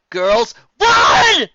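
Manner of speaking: high, loud urgent yell; loud commanding shout. A narrator's reading. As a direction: yell at them to run. Final yells warning.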